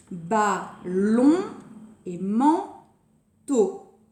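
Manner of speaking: Ballon as bal, lon and manteau as man, teau; drawn-out, separated syllables in Ballon and manteau. Shown as a demonstration. Teacher giving another example to pupils, syllable by syllable.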